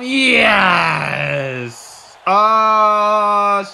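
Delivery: loud, long drawn-out yell; thrilled commentator holler; excited cheer. Real, not acted. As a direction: stretch each cry out in triumph and awe. Cheering another powerbomb.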